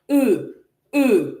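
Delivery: E and e said like E, O and U joined together; French E. Shown as a demonstration. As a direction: round the lips for the French e sound. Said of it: "the E is like E, O, and U joined together".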